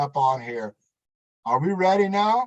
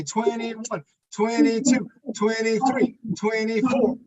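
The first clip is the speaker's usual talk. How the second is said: doing jumping jacks; 6 semitones higher than usual. Real, not acted. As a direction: call the count while jumping. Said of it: "Ben doing jumping jacks".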